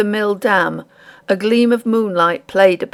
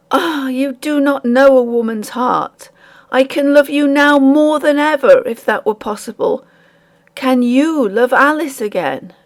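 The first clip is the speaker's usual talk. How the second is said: raised, heartfelt plea; earnest pleading; 6 semitones higher than usual. Acted. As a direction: beg him with all your heart. Girl pleading with the miller.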